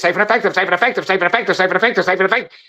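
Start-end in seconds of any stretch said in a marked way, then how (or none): 0.0-1.0 mocking repetition. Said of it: "if I say it enough time". 1.6-2.6 safe and effective, safe and effective rattled off fast; fast mocking rattle. Shown as a demonstration. Speaker mocking officials who repeat the slogan, fast rattle. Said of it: "and I say it fast enough".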